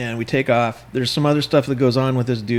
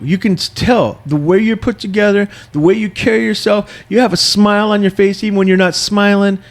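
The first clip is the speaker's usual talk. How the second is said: high, loud imitated gushing; gushing flattery; 6 semitones higher, 5 dB louder than usual. Man flattering a stranger on a plane, loud high gushing. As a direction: lay on the compliments, raised and emphatic.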